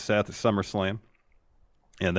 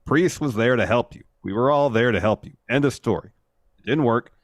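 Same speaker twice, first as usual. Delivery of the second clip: loud, stern mock scold; 3 semitones higher, 6 dB louder than usual. Fan voicing Rhea scolding Finn and Priest, loud and stern. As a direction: lay down the law, hammed-up. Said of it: "Rhea runs in and is like".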